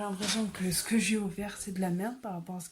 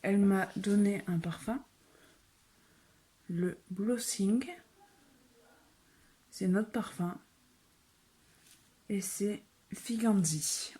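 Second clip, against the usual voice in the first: normal talking voice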